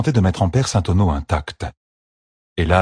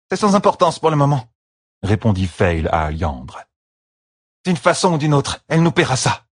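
higher, firm promise of payback; Fail to Alliandre, grimly; 7 semitones higher than usual. A narrator's reading. Fail vowing revenge. (promesse de vengeance ferme)

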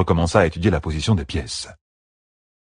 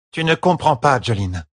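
companion's voice; 6 semitones higher than usual. A narrator's reading. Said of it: "dit une de ses compagnes".

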